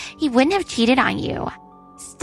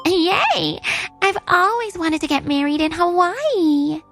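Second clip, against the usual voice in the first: high, delighted squeal; giddy, higher-pitched cheer; gleeful bride-to-be; 8 semitones higher, slower than usual. Acted. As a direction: gush over the wedding plan. Mistress cheers the wedding plan.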